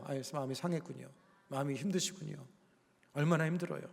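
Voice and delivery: soft, low, consoling voice; soothing sympathy. Shown as a demonstration. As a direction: comfort them gently instead of lecturing. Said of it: "마음을 만져주고".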